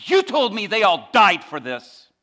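high, loud, slow outcry; impassioned protest. Retold or imitated. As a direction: throw it back at your teacher with force. Inmate protests, holding up Bible.

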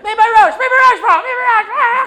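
top-of-the-lungs scream, very high and loud; frantic shouting to get served. Retold or imitated. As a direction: yell it as loud as you can in the queue. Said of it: "I started screaming at the top of my lungs".

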